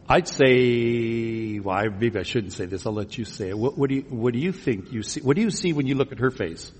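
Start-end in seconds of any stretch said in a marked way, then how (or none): none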